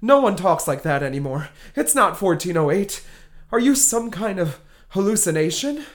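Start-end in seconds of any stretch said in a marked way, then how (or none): none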